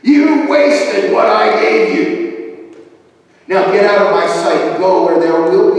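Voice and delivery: furious, loud banishment; wrathful shout from the pulpit; thundering master. Acted. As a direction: cast the servant out in a booming voice. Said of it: "his master thunders at him".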